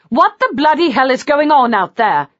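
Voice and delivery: loud angry shout, pitched high; furious bellow at the commotion. A narrator's reading. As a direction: yell it at the racket in the hall. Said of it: "she shouted".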